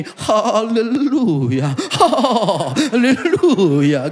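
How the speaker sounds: slow, drawn-out quavering hallelujah; mock revivalist preacher stretching each syllable; shaky preacher hallelujah. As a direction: ham up a trembling faith healer. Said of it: "kalau ngomong gini".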